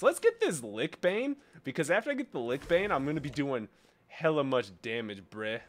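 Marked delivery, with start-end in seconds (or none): none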